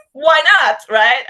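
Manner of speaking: giggle, then toss out "why not, right?"; laughing. Real, not acted. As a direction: laugh it off, loud and high. Laughs at her own queer Sherlock.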